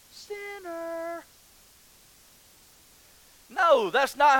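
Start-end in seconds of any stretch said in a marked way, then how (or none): 0.1-1.2 high, very soft, drawn-out accusation; mock shaming. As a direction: stretch it out in a tiny, eerie high voice. Mock public shaming on stage.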